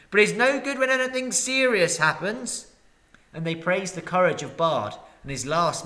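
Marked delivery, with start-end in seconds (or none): 0.1-2.7 grumbling townsfolk. Acted. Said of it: "some murmured".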